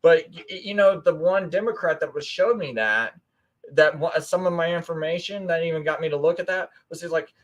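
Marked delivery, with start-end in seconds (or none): none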